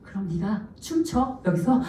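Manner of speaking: retold ear whisper, softly voiced; imitated stage whisper to son. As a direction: lean in and confide it quietly. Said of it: "귓속말 이렇게 얘기했어".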